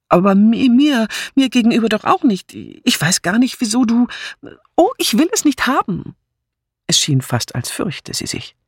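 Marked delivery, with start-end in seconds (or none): 0.1-6.1 rising, stammering panic; flustered protest. Acted. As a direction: push the refusal higher until it cracks. Philippa flustered, refusing inheritance.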